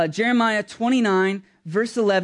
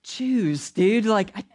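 God's reply; 2 semitones higher than usual. Pretend. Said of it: "God's just kinda quiet and like".

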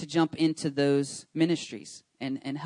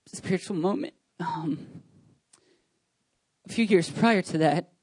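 choked up, holding back tears; emotional; 2 semitones higher than usual. Real, not acted. Pastor telling the church his story, choked up. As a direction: voice catching with real emotion. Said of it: "I'm getting emotional".

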